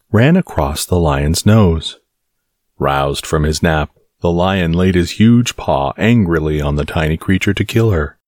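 ordinary delivery

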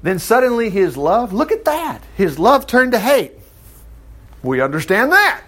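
normal talking voice

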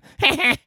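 high, quick little cackle; cackly laugh. Shown as a demonstration. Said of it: "That's a better laugh than".

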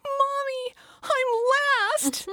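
put-on very high, gleeful little-kid voice; a beaming two-year-old telling Mom; joyful toddler. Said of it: "and he goes".

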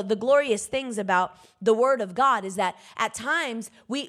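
ordinary delivery

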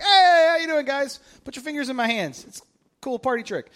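high, loud breezy greeting; casual Jesus greeting. Acted. Jesus breezing in to greet his disciples, high and loud. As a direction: toss it off like a friendly party host.

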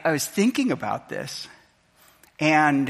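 ordinary talk

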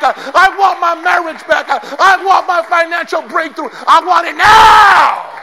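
shouting at full force, refusing to let go; desperate shout. Acted.

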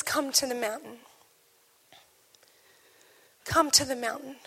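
quiet, tender call; gentle invitation. Acted. God calling Elijah to the mountain, tender and hushed. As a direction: draw them in softly.

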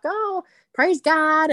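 mock a Southern church lady's slow Southern praise; Southern accent. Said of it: "They talk with that accent".

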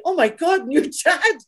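high-pitched gasping realisation, retold; excited squeal, edged with laughter; shocked recognition. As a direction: imitate your own amazed penny-drop moment.

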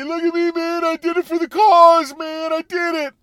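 high, loud smug dude voice; mocking protester. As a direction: sneer it like a preening activist. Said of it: "and then they can say".

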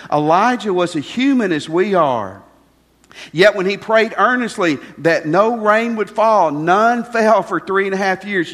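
loud, driving preached verse; forceful scripture reading. Acted. Preacher reads Elijah's verse to the congregation, loud and driving. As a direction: proclaim it to the congregation.

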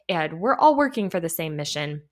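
ordinary talk, nothing marked

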